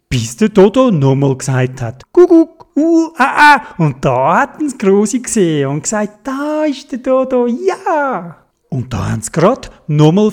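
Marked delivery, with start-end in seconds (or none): 2.1-3.6 much higher, louder squawky cuckoo; Dodo bird call. A narrator's reading. Dodo calls again. 6.2-8.4 raised, pleased exclamation; delighted grandma. A narrator's reading. Grandma spots Dodo.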